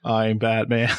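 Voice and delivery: low, robotic hero impression; mock a stiff, robot-like superhero voice; Batman. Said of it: "Like a robot".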